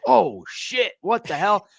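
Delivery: high mock shock at a near accident; startled alarm. Vet retells his shock at almost sharting, mock alarm. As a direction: jolt up like something just went wrong. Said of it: "I was like".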